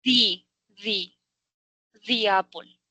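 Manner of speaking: "di" repeated, tongue between teeth, ee sound, then "apple"; teacher drills "di" before a vowel. Shown as a demonstration. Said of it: "Esta empieza en vocal".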